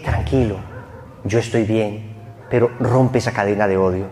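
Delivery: soft, low reassurance; calm him gently from beyond; soothing brother. Acted. The murdered brother in a dream comforts him, softly.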